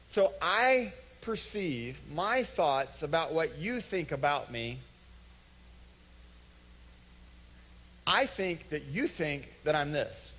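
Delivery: slowed explaining. Real, not acted. Spelling out a point slowly.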